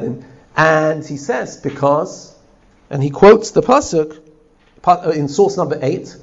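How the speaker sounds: ordinary talk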